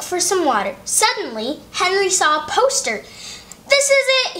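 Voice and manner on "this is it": much higher, excited realization; light up with a plan; sudden idea. Acted. Henry spots the poster and gets excited, much higher. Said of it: "he thought".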